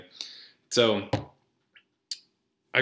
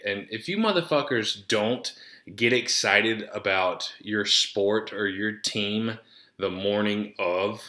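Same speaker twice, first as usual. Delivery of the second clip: normal talking voice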